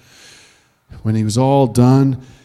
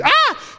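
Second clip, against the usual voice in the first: scream. Acted. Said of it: "She screamed".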